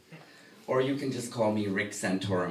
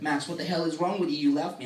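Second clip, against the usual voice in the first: higher-pitched, mild mock yell; mock scolding, pitched up; retold screaming; 6 semitones higher, 6 dB louder, faster than usual. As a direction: imitate an upset girlfriend's rant, half-hearted. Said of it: "screaming at me".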